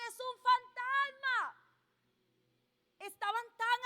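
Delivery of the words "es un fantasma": grito de miedo. A narrator's reading. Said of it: "y gritaron".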